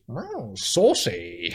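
very slow teasing "Wow, saucy"; slow drawn-out "saucy". Retold or imitated. Woman in the crowd fills the comic's pause, very slow tease. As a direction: stretch it out like a cheeky aside. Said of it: "she just goes".

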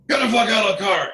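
angry cop yell. Retold or imitated. Texas officer ordering a lady out of her car, angry yell. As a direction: bark it like a furious cop at a traffic stop.

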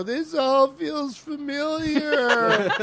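high, slow, wailing whine; whiny actor voice. Retold or imitated. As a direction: stretch it out in a crying wail. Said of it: "Tom Matthews starts to go".

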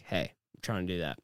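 normal talking voice

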